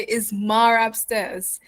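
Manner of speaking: slow New York "Is Mar upstairs"; unhurried New York accent demo. Shown as a demonstration. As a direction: model it slowly as a New Yorker, R linking "Mar" to "upstairs". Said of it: "you can try to actually say it".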